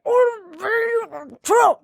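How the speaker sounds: high garbled mock voice; Conor McGregor. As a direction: mangle it into high gibberish, then the name. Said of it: "He's gonna be like".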